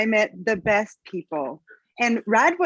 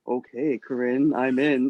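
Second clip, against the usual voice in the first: dropped much lower, resolute; suddenly deep voice; 10 semitones lower than usual. Retold or imitated. Student telling Corinne she signed up, in a deep voice. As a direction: imitate your own voice fallen octaves.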